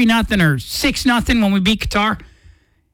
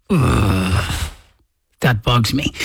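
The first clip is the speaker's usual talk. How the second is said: much lower, grumbled fan reaction; mock annoyance; 10 semitones lower, much slower than usual. Imitating a die-hard fan hearing the score, mock-annoyed. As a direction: act out a disgusted low "Ugh".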